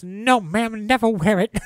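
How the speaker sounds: high, timid put-on voice for Lou; Lou's meek reply. Lou answering the lady about the nightgown, high and timid. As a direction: squeak it out like a nervous bumbler. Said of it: "And Lou goes".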